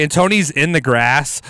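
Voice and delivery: ordinary delivery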